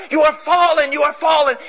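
loud, high cry of fallen; God screaming. Acted. God screams to mankind in mercy, loud.

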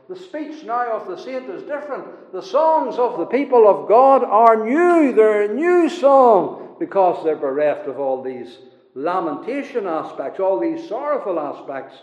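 high, loud sermon proclamation; forceful preaching. Real, not acted. Preacher proclaims the new song of God's people, forceful preaching. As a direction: ring it out high to the congregation.

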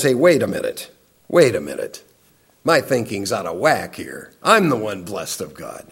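low, softened voice as it dawns on you; hushed realization. Retold or imitated. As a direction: quote a quiet moment of coming to your senses. Acting out the psalmist's dawning realization.

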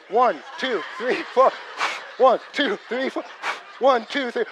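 loud, high, urgent count; counting to hold it in while driving; Lamaze counting. Retold or imitated. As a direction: breathe through the cramps.

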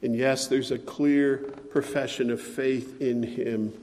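normal talking voice

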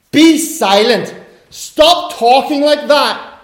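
loud, high, slow rebuke; Jesus sharply silencing his disciples; stern command. Acted. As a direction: bark the order to silence them.

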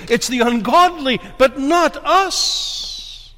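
building from firm to high, forceful exhortation; impassioned preaching. Real, not acted. Preacher exhorting flock facing pandemic and government, impassioned preaching. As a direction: drive each "afraid" home, climbing in pitch.

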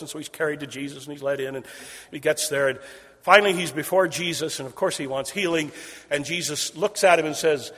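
usual speaking voice

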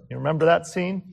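ordinary talk, nothing marked

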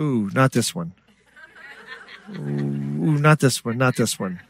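quiet, wincing "ooh"; hushed mock dismay; put-off reader. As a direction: recoil softly from what you read. Acts out rejecting unwelcome Psalms.

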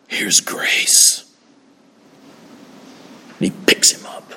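hushed, breathy whisper of grace; preacher whispering the good news to his congregation. Real, not acted. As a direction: drop to a whisper as if sharing a secret.

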